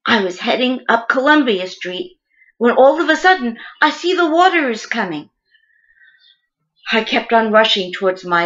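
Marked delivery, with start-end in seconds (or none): 2.6-5.3 higher, urgent rush of alarm. Acted. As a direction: tell it as the danger suddenly appears. Recounting sudden rising flood water.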